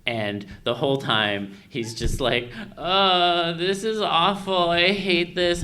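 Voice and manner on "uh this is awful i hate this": much higher and louder, slow whiny moan; mock whiny dragon.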